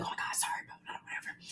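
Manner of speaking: mock hushed whisper, dismissive; whispered apology. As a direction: whisper it like a scolded guest brushing it off. Women apologising to the employee.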